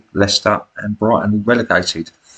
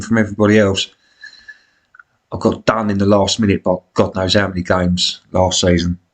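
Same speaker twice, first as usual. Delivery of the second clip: usual speaking voice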